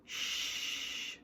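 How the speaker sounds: soft voiceless shush; quiet conspiratorial shh. Acted. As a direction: hush as if sharing a secret. Secret escape plan hush.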